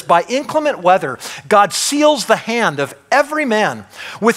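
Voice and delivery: preaching at full force. Acted. Preacher urging the congregation, full force. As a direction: build the fire of the sermon.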